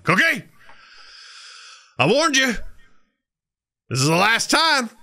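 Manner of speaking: high-pitched mock-furious yell; hammed-up scolding. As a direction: scold it like a misbehaving child, exaggerated. Man scolds cookie in joke.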